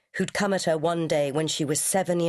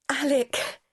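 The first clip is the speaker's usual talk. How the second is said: startled; 9 semitones higher than usual. A narrator's reading. Janet sees his reflection.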